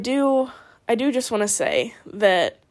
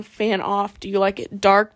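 normal talking voice

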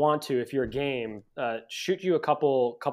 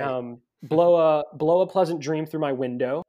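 mock British accent; 4 semitones higher, 7 dB louder than usual. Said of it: "Good accent".

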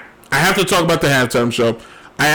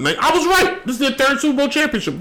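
high, triumphant "I was right"; 9 semitones higher than usual. Real, not acted. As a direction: crow it high, pleased you were right. Checks stat, finds correct.

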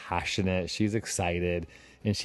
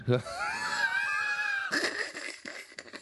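very high, squealing laugh; long, breathless burst of laughter; helpless laugh; 4 dB quieter than usual. Real, not acted. Host laughing at own forgetful story, high helpless laugh.

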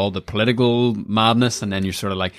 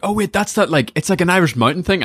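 high-pitched sudden recognition; excited realisation; 10 semitones higher, 3 dB louder than usual. Retold or imitated. Retelling stumbling on the famine memorial, excited recognition. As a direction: blurt it as if just spotting it.

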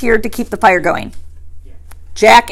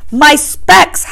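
high, raised howl of protest; 6 semitones higher, 4 dB louder than usual. A narrator's reading. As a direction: howl it out in alarm. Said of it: "howled Piggy".

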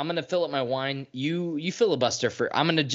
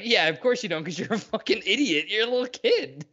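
higher, scornful jeer with a laugh; mocking sneer; 8 semitones higher than usual. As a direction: sneer at him while laughing. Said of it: "And it's like".

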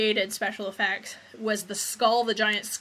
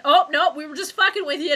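higher, louder mocking movie-twist voice; mock gotcha; 6 semitones higher, 8 dB louder than usual. Critic mocking bullshit horror movie endings, higher loud mock gotcha. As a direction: burst in loudly with a hammed-up gotcha.

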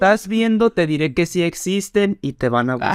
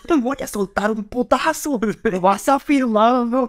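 mock threat; 6 semitones higher than usual. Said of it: "Y el otro dice".

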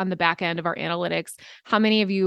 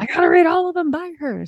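eager inner voice; 9 semitones higher, 3 dB louder, faster than usual. Retold or imitated. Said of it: "So then I'm like".

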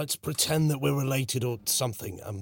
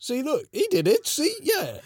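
much higher, gleeful gloating; excited teasing; 7 dB louder than usual. Acted. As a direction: crow at them, delighted.

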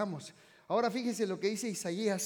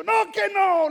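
loud refusal; 13 semitones higher, 15 dB louder, slower than usual. Retold or imitated.

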